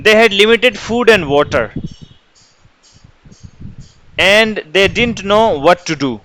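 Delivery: unhurried, word by word, clear English reading; slow dictation reading. Shown as a demonstration. Teacher dictating the story passage to the class, slow and clear. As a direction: pace it so every student can keep up.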